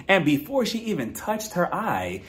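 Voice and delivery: normal talking voice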